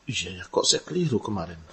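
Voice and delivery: a humbled man quietly admits his mistake; quiet admission. Retold or imitated. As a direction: concede it quietly. Voices person admitting error.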